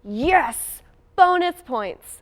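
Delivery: retold gleeful shout, high and drawn out; mock-cheer it like a kid hearing good news; Yes! Bonus points! sung out high and loud; excited cheer. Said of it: "I was like".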